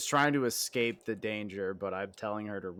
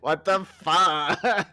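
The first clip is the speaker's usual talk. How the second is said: slow, much higher and louder drawn-out swear; valley girl Jesse; 8 semitones higher, 10 dB louder, much slower than usual. Retold or imitated. Riffing on Breaking Bad with a teammate, slow valley girl yell. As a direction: stretch it out in mock valley girl outrage. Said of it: "replaced with a valley girl".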